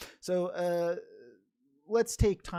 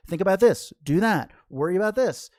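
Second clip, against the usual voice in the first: loud, punchy list of mock commands; mock nagging orders; 4 semitones higher, 9 dB louder than usual. Busy mind giving orders to its owner, mock nagging. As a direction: play a busy mind firing off demands. Voicing the busy mind's demands.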